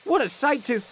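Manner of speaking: high, bright-eyed delight; excited exclaim. A narrator's reading. Ben admiring the cleaned jet engine, high and thrilled. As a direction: gasp at the shiny engine.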